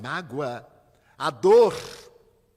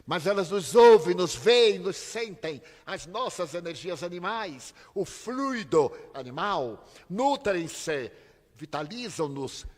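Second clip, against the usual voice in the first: louder, higher impassioned preaching; fiery preaching; 6 semitones higher, 9 dB louder than usual. Acted. Preacher warning the congregation about spirits, in full fiery preaching. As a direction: lift the crowd, pound each phrase.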